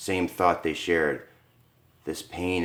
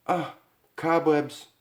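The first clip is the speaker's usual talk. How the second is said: plain reading voice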